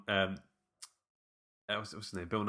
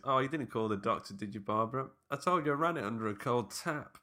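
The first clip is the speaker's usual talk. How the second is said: Bill Nighy; 3 semitones higher than usual. Retold or imitated. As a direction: play the fussy stepdad dismissing a doctor. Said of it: "That was a good Bill Nighy impression".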